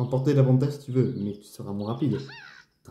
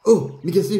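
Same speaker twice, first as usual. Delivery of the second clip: surprised call; 7 semitones higher, 6 dB louder than usual. Acted. Greeting character in game.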